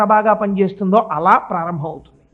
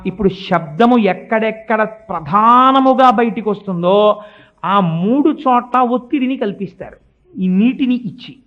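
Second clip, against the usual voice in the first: normal talking voice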